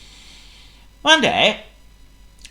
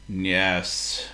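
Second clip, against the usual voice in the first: grumpy; 5 semitones lower, 6 dB quieter than usual. A narrator's reading. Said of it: "replied Potto grumpily".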